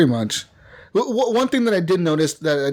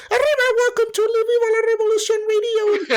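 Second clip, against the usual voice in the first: mock radio host. Imitating the game's radio hosts, high exaggerated announcer voice. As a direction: wail it like a parody Spanish radio jingle. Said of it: "They all do that sh".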